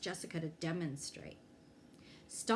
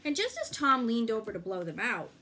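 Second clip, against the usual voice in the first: usual speaking voice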